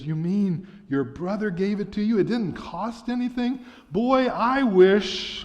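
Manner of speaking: put-on rising, amazed boy's voice, slowing on the wish; a lightly voiced boy, wide-eyed with wonder; astounded boy. A narrator's reading. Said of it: "the astounded boy says".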